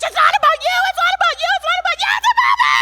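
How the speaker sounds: high-pitched screaming, very loud; screaming at strangers. Retold or imitated. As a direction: shriek it at passersby on the beach. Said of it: "began screaming at strangers".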